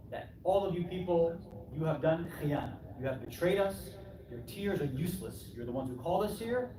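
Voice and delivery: usual speaking voice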